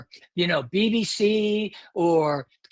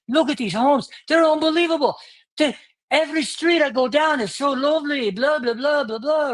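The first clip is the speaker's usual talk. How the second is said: higher-pitched, lilting mock Irish accent; put-on Irish accent, gushing and higher; attempted Irish accent; 6 semitones higher than usual. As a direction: imitate an amazed Irish tourist's accent. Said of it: "I'll do an Irish accent".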